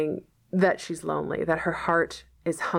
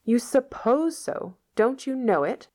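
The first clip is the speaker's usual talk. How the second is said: incredulous; 7 semitones higher than usual. A narrator's reading. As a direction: challenge him in disbelief. Marilla challenges Matthew.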